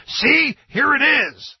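imitating a triumphant gotcha; mock triumph. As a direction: crow it like you found proof. Mimics conservatives seizing on lyrics.

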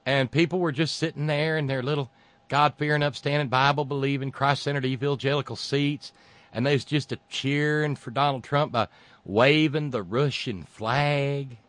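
mock folksy. Host mocking the flag-waving crowd, mock folksy.

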